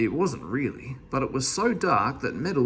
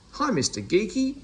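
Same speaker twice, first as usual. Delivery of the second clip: calling out; 7 semitones higher than usual. Acted. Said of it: "he called".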